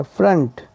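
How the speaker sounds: फ्रंट with a short schwa vowel. Shown as a demonstration. Teacher showing O sounds as short A, "frunt" for फ्रंट. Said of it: "ओ की आवाज़ अ की है".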